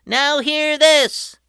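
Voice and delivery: high, loud, slow captain's call; megaphone announcement. A narrator's reading. Patrick announcing through his megaphone, slow and booming. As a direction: boom it like a captain. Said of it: "Patrick announced through his mega megaphone".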